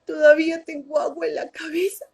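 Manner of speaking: voz de niña llorosa, más aguda; niña llorando. Retold or imitated. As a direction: lloriquear como una niña pequeña. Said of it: "llorando, le decía". ("abuelito" llorando, más agudo y entrecortado)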